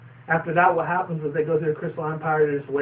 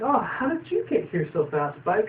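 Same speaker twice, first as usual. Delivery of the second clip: hint of Twilight; 6 semitones higher than usual. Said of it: "Twilight's all like".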